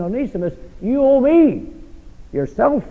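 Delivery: high, ringing preacher's voice, punching home the debt; emphatic Paul. Retold or imitated. Paul to Philemon, high and ringing, pressing the debt. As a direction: drive it home like Paul pleading.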